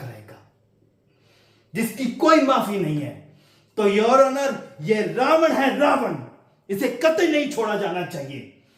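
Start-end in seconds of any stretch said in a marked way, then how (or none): 1.7-8.6 raised, higher courtroom outburst; impassioned plea. Acted. Lawyer demanding no mercy for Ravana, impassioned. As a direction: build to a forceful, loud demand of the judge.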